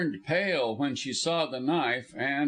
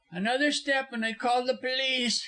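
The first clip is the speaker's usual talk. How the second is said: high-pitched, voiced warning; cowardly warning, pitched way up; frightened threat; 12 semitones higher than usual. A narrator's reading. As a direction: threaten from a position of fear. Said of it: "he whispered".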